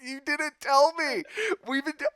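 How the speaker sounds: high, loud, exasperated disbelief; hammed-up betrayed protest; mock outrage. Retold outrage at family.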